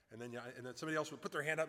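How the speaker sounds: normal talking voice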